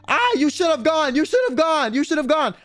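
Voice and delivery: road-rage yell. Retold or imitated. Retelling an Uber driver's road rage, mock yelling. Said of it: "Like, really loud".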